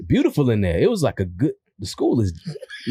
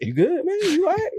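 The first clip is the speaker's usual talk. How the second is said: much higher, squeaky concerned voice; mock concerned friend. Friend asks him what he said, mock concerned and high. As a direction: play the baffled buddy checking in. Friend reacting to his joke.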